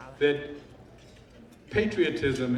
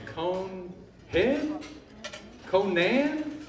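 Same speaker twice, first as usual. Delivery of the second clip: higher, tentative questioning; puzzled guessing; 5 semitones higher, much slower than usual. Acted. Old-time attorney asking the audience a fella's name, puzzled guessing. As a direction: try out an unfamiliar name, unsure.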